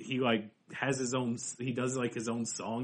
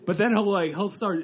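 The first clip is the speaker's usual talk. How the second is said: ordinary talk, nothing marked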